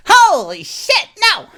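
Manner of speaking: high, loud terrified shriek; panicked scream. Acted. Rogue sees the monster and screams in panic.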